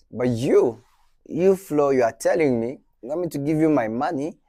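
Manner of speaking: incredulous investor. Retold or imitated. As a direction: voice rising, you really want my money. Investor doubting a risky pitch.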